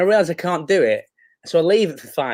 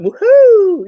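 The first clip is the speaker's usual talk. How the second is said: loud, very high celebratory "woohoo"; retold whoop; 10 dB louder than usual. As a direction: imitate a big gleeful whoop. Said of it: "Yeah, I went".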